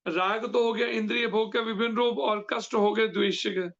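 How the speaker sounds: usual speaking voice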